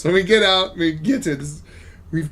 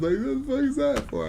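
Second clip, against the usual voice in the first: mock disbelief; 9 semitones higher, 4 dB quieter than usual. Friend reacting to botched mission.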